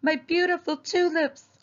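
high-pitched lament in a put-on voice; distressed neighbor's high cry; dismayed cry. A narrator's reading. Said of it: "she cried".

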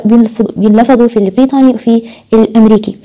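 ordinary delivery

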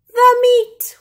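crew roar. A narrator's reading. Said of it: "we all roared".

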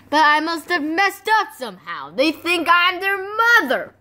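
ordinary talk

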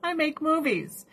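high, breezy dismissive voice; lightly voiced careless movie star; imitating Tom Cruise. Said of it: "He's like".

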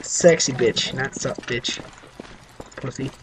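soft muttered words. Real, not acted. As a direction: say it under your breath. Muttered correction and insult.

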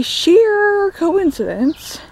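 high, slow mocking sheer coincidence; sarcastic sheer coincidence; sarcastic emphasis. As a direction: drip irony over sheer coincidence. Mocking the plot convenience again.

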